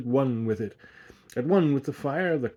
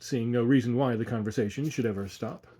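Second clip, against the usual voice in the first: ordinary delivery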